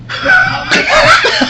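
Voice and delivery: a shrieking, laughing wail, very high; imitating Keanu crying out mid-bite, laughing through it; mock wailing sob. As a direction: cry out in comic anguish. Imitating Keanu's reaction to slaughter.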